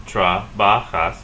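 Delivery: "Trabajas" in three slow separate beats; slow, divided "Trabajas", J as a raspy H; syllable by syllable. Shown as a demonstration. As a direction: break "Trabajas" into tra, ba, jas. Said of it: "you're gonna have three syllables".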